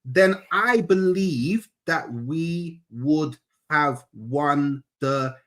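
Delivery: slow, deliberate word-by-word delivery; really slowly. Shown as a demonstration. Exasperated fan repeating his point to his debate partner, really slowly. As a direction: spell it out slowly, phrase by phrase. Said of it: "I'm gonna say it really slowly".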